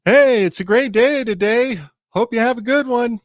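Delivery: high, loud hail to nobody in particular; cheery yell. Acted. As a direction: call out high and loud into the air. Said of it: "he just yells ambiguously into the air".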